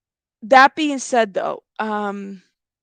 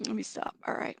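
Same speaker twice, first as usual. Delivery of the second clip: much softer, almost to herself; quiet; 13 dB quieter than usual. Real, not acted. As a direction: murmur it under your breath to regain composure. Composing herself after laughing.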